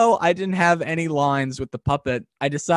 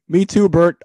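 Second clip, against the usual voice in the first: Ernie voice; 4 semitones higher, 8 dB louder than usual. Retold or imitated. Ernie and Bert blooper.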